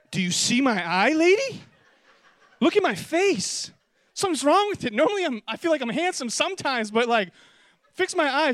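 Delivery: high, loud desperate pleading; hammed-up panic, pointing at his face; frantic plea. Patient begging the doctor to fix his eye, frantic.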